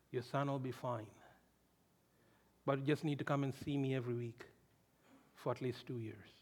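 low, very soft, measured doctor voice; calm reassuring doctor. Retold or imitated. Doctor telling parents their sick son is treatable, calm and hushed. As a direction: quietly reassure worried parents, imitating a doctor.

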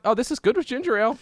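high, bright quoting of her past self; mock delighted. Telling friends how she found the drink good. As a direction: mimic yourself loving the drink. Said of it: "I'm like".

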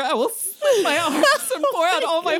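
loud, high-pitched over-the-top fangirl gushing; hammed-up passionate outburst; fanatical devotion. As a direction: proclaim it like a wildly devoted fan. Mock fan comments, gushing devotion.

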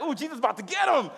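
high, excited "ooh"; like a kid watching someone get in trouble; gleeful anticipation. Retold or imitated. Gleefully voicing his first reaction.

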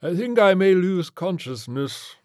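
high, slow, loud swooning; faltering rich gentleman. A narrator's reading. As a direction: announce a coming faint pompously. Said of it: "Mister Flintlock said".

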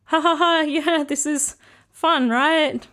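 acted-out uncomfortable giggle, pretending it's fun; nervous giggle. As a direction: force a high, uneasy laugh through the words. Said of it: "That's a, a, a nervous giggle".

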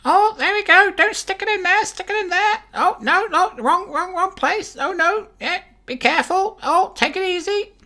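very high squawking parrot voice, hammed up; very high parrot voice, mock suggestive; parrot phone sex line. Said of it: "fantastic for phone sex lines".

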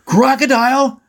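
high-pitched alarmed shriek; acted panicked yell. A narrator's reading. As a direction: cry out the danger, voice shooting up. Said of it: "Brock shrieked".

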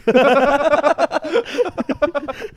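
long, high, loud cackle; loud high laugh. Real, not acted. Guy laughing at his own dirty joke.

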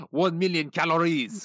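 high, slow, put-on French accent; exaggerated French accent, drawn out. Shown as a demonstration. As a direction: lay on a fake French accent, slowly. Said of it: "where's your accent?".

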